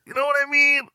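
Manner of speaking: very high comic squeak; squeaky high question. Pretend. Host checking with listeners, squeaky high. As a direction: shoot the pitch way up.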